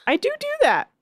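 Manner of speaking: high-pitched indignant insistence; defensive protest. Real, not acted.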